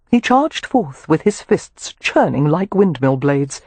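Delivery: ordinary delivery, nothing marked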